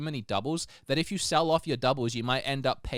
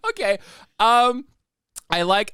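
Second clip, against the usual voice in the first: ordinary talk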